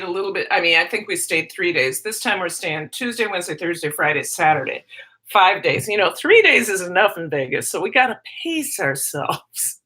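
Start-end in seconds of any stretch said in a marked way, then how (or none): none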